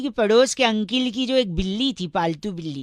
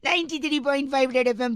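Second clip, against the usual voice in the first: radio announcer; 4 semitones higher, 4 dB quieter than usual. Acted. Radio jockey intro.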